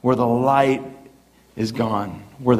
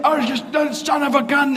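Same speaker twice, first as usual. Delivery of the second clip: mock cursing pastor; 12 semitones higher than usual. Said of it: "he was like".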